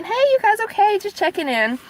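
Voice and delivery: high-pitched casual check-in; breezy check-in. Retold or imitated. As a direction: check in lightly, voice lifted high.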